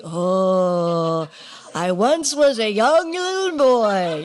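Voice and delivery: a hint of a slow, dramatic storyteller voice, half laughing; mock memoir narrator. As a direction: lightly ham up a sentimental memoir opening. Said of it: "It's like".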